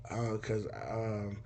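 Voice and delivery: low, very soft, hesitant; timid mumble. Retold or imitated. Son on phone asking dad for food, timid mumble. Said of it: "speak up".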